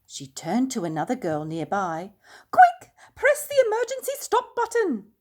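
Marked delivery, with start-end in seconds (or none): 2.5-5.1 high, loud urgent order; urgent call. A narrator's reading. Girl urgently orders a stop.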